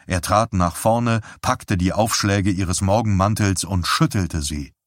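ordinary delivery

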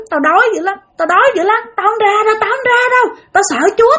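very high, insistent complaining voice; possessed boy pleading. Acted. Possessed boy under the altar, high insistent refusal. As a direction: protest repeatedly, pitched right up. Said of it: "Nói vậy đó".

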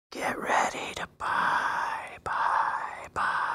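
slow, spooky whisper with echoing repeats; theatrical whisper. Pretend. Creeper at the window whispering to the viewer, mock spooky whisper. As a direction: whisper it like a lurker in the dark. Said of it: "I whisper".

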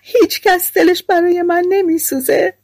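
high-pitched, acted lament of a miserable girl; plaintive storybook wail; tearful wailing. A narrator's reading. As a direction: wail it in self-pity, pitch climbing steeply. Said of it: "ناله و گریه".

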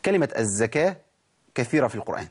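ordinary talk, nothing marked

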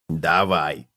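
cockroach calls out. A narrator's reading. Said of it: "крикнул таракан".

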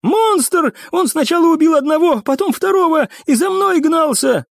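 very high, frantic account; panicked report. A narrator's reading. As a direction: pour it out in panic, pitch way up. Terrified cadet reports the monster.

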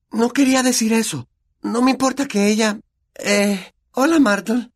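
put-on high girl voice, caught out; flustered girl. Hermione caught gossiping, flustered and high.

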